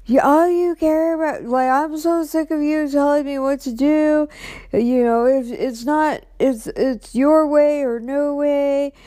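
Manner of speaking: hammed-up angry boyfriend, slowing at the end; mock ranting boyfriend. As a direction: imitate him going off at her. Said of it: "went off the handle again".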